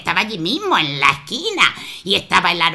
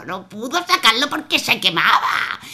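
ordinary delivery, nothing marked

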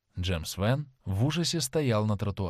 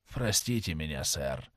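much softer, lower apology; whispered plea; 7 semitones lower, 6 dB quieter than usual. A narrator's reading. A shaken sailor apologizes, whispering to the man he nearly shot. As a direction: breathe the apology softly. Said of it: "прошептал Джемс Вен".

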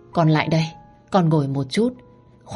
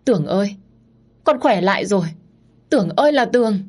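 tearful joy; 4 semitones higher, faster than usual. A narrator's reading. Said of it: "vui mừng phát khóc".

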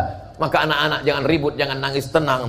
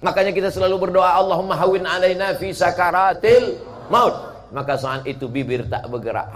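ordinary delivery

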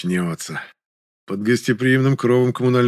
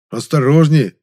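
higher, louder cry of caution; exclaimed warning; 5 semitones higher, 7 dB louder, slower than usual. A narrator's reading. Narrator cautions injured Гришка, exclaiming loudly. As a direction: call out a sudden warning. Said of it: "опять воскликнул я".

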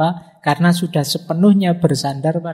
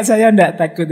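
mock yell; 5 semitones higher, 5 dB louder than usual. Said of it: "tapi teriak".

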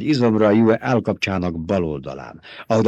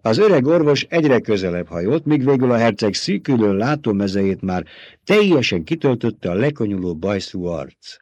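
usual speaking voice

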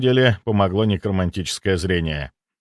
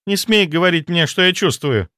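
higher, indignant woman's protest; 7 semitones higher, 3 dB louder than usual. A narrator's reading. Rejected lover forbidding him to judge her feelings, indignant and high.

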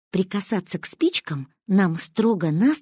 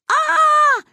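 long, loud high-pitched scream; high yell of panic; panicked scream; 5 dB louder than usual. A narrator's reading. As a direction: scream out in alarm like frightened children. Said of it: "заорали мы".

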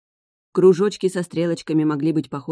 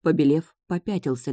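ordinary delivery, nothing marked

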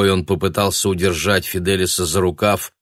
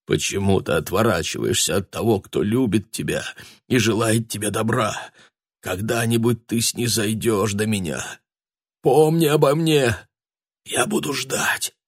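sorrowful; 3 semitones higher than usual. A narrator's reading. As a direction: beg him sorrowfully, breaking at "remember me". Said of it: "Его горестный голос".

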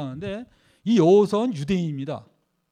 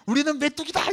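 much higher, a hint of whiny defeat; imitating the fearful spies; 8 semitones higher than usual. Preacher contrasting the fearful spies' words, mock defeat. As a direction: say it like a beaten man. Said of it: "이거 하고 차이가 나요".

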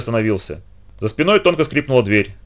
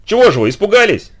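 higher, challenging call; бодрый крик; 8 semitones higher, 4 dB louder than usual. A narrator's reading. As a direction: call out to cheer yourself up. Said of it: "я крикнул". (задорно выкрикнуть)

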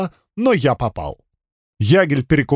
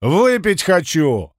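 slow slurred demand, pitched up; slurred drunk shout; 4 semitones higher, slower than usual. Acted. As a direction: whine for a drink like a drunk. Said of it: "изображая пьяного".

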